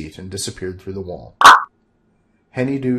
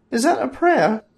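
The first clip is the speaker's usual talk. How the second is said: high, louder sorceress voice; Valerina's voice; 5 dB louder than usual. Acted. Valerina asking Bob, high sorceress voice. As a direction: ask it innocently as the sorceress.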